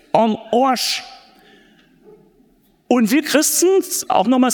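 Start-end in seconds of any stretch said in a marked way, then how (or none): 0.1-1.0 "am Arsch" said the Bavarian way, like "on Oasch"; Bavarian accent. Shown as a demonstration. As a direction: give "am Arsch" a broad Bavarian twang. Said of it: "sag's mal auf Bayerisch". ("am Arsch" auf Bayerisch, wie "on Oasch")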